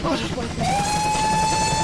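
high drawn-out wail. Acted. Mother after tooth pulled.

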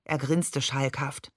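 ordinary delivery

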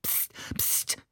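unvoiced hissing shush; hissed shush. A narrator's reading. Ali hushing the laughing brothers because of the neighbours, hissed. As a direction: hush them sharply under your breath. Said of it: "zischte Ali".

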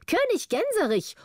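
high-pitched taunting cry; mock shriek. A narrator's reading. Governor fleeing the king, crying insults in a high voice. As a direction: fling it out high, without real volume. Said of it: "allerlei unerhörte Worte schrie".

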